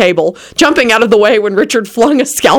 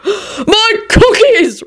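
very high, drawn-out wail; girlish shriek; much slower than usual. A narrator's reading. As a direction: shriek it like a girl, stretched out. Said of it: "shrieked like a girl".

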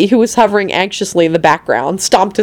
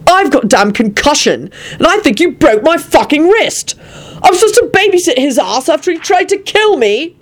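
high, climbing outraged protest; indignant shout; 10 semitones higher than usual. A narrator's reading. Quentin objecting to babysitting Lupin, shouting. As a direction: protest hotly, climbing higher. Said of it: "Quentin shouted".

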